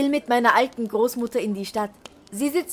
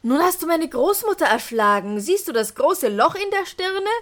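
accusing; 6 semitones higher, 3 dB louder than usual. Acted. Klaus accuses the innkeeper.

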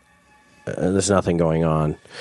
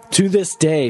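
usual speaking voice

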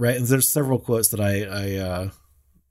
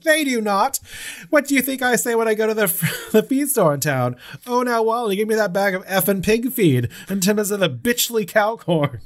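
Annie scolding; 11 semitones higher, 3 dB louder than usual. Retold or imitated. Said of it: "She's like".

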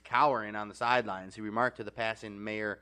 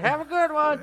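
high, loud sing-song send-off; breezy as if nothing happened; mock cheery farewell; 7 dB louder than usual. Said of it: "He said, he said".

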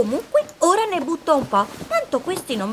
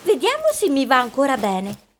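ordinary delivery

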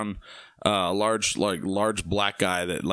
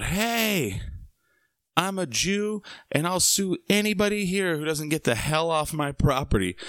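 retold yell; 7 semitones higher than usual. Host yelling from the wall at a brawling party, mock yell. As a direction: call it out high, as if over a crowd. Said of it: "and I yell out".

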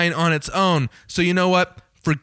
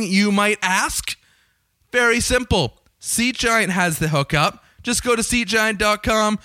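higher, upbeat sales pitch; sponsor ad read; 4 semitones higher than usual. Acted. Host pitching the sponsor's promo code to listeners, cheery ad voice. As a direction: sell the ticket site with a grin.

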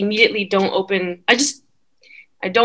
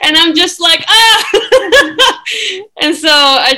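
high, loud, laughing exclamation; 11 semitones higher, 6 dB louder than usual. Real, not acted. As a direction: laugh through it, ending in a high cry. Laughing at the 4:44 coincidence.

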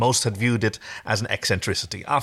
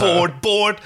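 put-on bored whiny kid; 10 semitones higher, 5 dB louder than usual. Bored kid whacking game mallet.

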